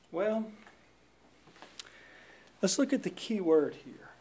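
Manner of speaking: ordinary talk, nothing marked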